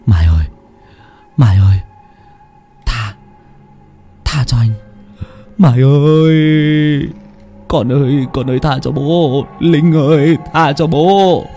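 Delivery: desperate pleading cries; begging. A narrator's reading. Drunk father begging his dead wife and daughter, wailing. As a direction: beg for mercy, wailing the names. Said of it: "vừa nói vừa lạy như tế sao".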